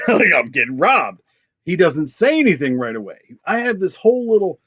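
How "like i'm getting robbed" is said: said through a laugh. Retold or imitated. Clerk retelling the robbery to his friend, laughing. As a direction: chuckle as you admit it.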